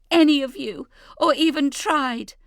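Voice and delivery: high, loud furious outburst; exploding in anger. Acted. Leah explodes at her family, high and furious. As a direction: let the anger burst out. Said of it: "Leah explodes".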